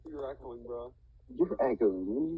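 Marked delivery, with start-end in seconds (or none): 1.4-2.4 drawn-out, wavering, louder echo; mock echo. Player teasing another about the echo on their mic, mock echo. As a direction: stretch out the end like an echo. Mimics echo on second take.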